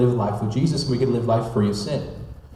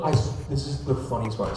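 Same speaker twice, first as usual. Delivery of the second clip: normal talking voice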